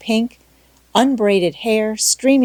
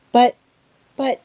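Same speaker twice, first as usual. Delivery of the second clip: faltering; 5 semitones higher, 4 dB louder than usual. Acted. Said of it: "faltered Anne".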